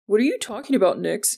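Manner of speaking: mildly odd, evasive phone voice; strange voice. A narrator's reading. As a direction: sound slightly strange, as if covering up. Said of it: "Jordan said in a strange voice".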